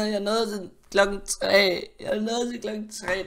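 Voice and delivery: high, drawn-out complaint; wailing lament. Real, not acted. Gamer laments a lost night to viewers, wailing. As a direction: moan about the loss, high and stretched. Laments losing at three o'clock.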